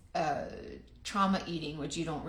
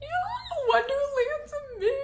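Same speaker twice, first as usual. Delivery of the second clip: excited squeal; 5 dB louder than usual. Real, not acted. Excited about gifted products.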